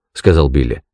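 plain reading voice

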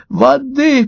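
high, thin fish voice begging; high-pitched little creature in distress; feeble gasping plea. A narrator's reading. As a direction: plead in a tiny high voice, not a true whisper. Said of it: "прошептала рыбка".

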